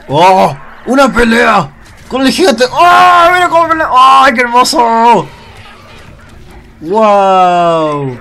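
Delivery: high, loud amazed yelling; excited shout of awe; thrilled shouting. Real, not acted. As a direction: go wild watching the giant fight. Thrilled watching giant fight.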